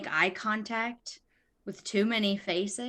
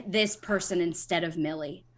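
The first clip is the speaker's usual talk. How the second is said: ordinary talk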